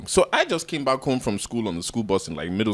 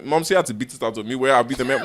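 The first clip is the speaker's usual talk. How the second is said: ordinary talk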